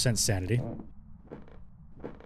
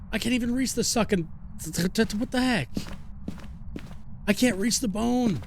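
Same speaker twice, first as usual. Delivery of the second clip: strained, much higher pitch; frustrated high voice; 8 semitones higher than usual. Real, not acted. Struggling to reach the bone.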